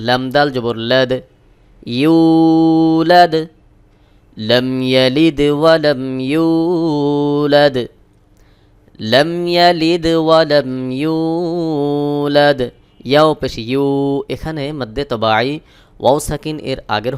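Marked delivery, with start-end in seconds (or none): 2.0-12.6 slow, drawn melodic tilawat; Quran recitation. Acted. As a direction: chant long and even. Recites the verse. (ধীর সুরেলা কুরআন তিলাওয়াত)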